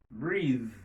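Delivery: "breathe" with long ee and buzzing th; voiced final th. Shown as a demonstration. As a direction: let the th of "breathe" hum. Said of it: "il vient sonore". ("breathe" ak th sonò)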